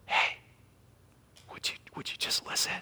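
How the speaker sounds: soft, fast whispered plea. Retold or imitated. Preacher voicing God gently calling us, whispered. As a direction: lean close and whisper it.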